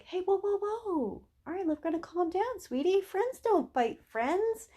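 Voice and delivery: high, sing-song coaxing; high-pitched "sweetie" voice for a pet; gentle chiding. Acted. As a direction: talk a dog down sweetly in a high voice. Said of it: "turn around and say things like".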